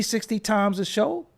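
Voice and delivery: ordinary delivery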